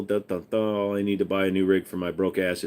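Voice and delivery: usual speaking voice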